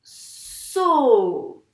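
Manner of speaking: loud, long stretched "So"; sounded out. Shown as a demonstration. Teacher saying the sounds while writing, drawn-out "So". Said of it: "je dis bien tous les sons".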